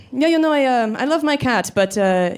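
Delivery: a light hint of a thick European accent; imitating a European date telling about his cat in a European accent. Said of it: "he had this really thick European accent".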